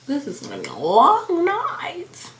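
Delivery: dramatic dread, LONG dragged out and swooping. Real, not acted. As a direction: stretch LONG and NIGHT in a rising swoop. Groaning about the late night ahead.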